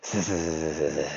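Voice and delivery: long sibilant creature hiss; drawn-out angry hiss. A narrator's reading. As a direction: hiss through the teeth like Gollum. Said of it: "hissed Gollum".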